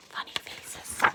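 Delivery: soft whisper; whispered aside. Real, not acted. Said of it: "aside about picture".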